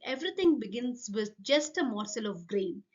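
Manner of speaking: ordinary delivery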